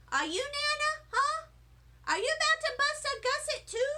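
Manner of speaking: put-on high, eager little girl voice; squeaky kid asking questions; excited child. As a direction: pester grandma with a big curious grin. Child pestering Nana, high voice.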